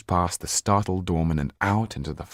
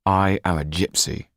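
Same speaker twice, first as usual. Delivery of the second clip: forced through clenched teeth; gritted teeth; 4 dB louder than usual. Acted. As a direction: push the words through your teeth. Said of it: "said Jan through gritted teeth".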